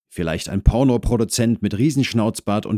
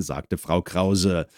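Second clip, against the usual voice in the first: ordinary delivery, nothing marked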